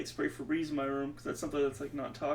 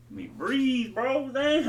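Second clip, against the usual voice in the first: higher and louder, fed up with the mosquito; rising frustration, each word louder; exasperated outburst; 7 semitones higher, 6 dB louder, slower than usual. Real, not acted. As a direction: blurt it out, annoyed and rising.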